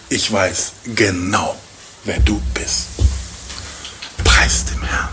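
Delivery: low, soft and slow, almost hushed; tender Boas. Acted. Preacher voicing Boas telling Ruth he knows her, hushed and tender. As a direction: speak to her gently, as if seeing her heart.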